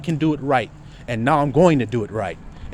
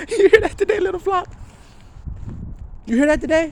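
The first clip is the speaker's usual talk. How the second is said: pitched way up to the flock; high calling out. Real, not acted. Preacher asks his little flock, high and teasing.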